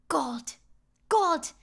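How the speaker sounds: greed dawning on him; awed. Acted. Fisherman seeing the gold coin, awed then excited. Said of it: "the fisherman's like".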